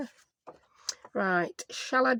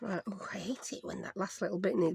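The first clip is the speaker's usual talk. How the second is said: whispered grumble; 7 dB quieter than usual. Real, not acted. Annoyed aside while trimming paper.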